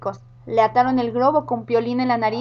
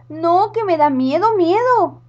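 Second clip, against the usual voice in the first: high, louder whimpering witch; scared witch; 5 semitones higher, 4 dB louder, slower than usual. Acted. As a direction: plead in fear. Witch scared at slide top.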